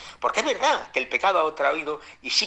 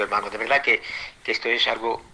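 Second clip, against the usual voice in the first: ordinary talk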